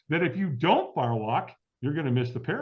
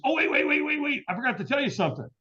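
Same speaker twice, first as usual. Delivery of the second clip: friend on the phone suddenly remembering, much higher; imitating excited friend; 7 semitones higher than usual. Friend remembering the fire walk, mock excited. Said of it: "he goes".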